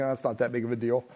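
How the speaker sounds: low, soft, quick inner voice; dismissive thought. Acted. Pastor thinking it over before praying, dismissive thought. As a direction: brush it off quickly under your breath.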